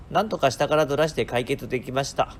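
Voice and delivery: ordinary delivery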